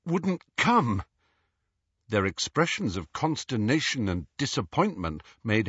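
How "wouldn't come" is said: winded, higher-pitched fragment; panting. A narrator's reading. Harry explaining his failed spell, out of breath. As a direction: push it out between breaths. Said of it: "he panted".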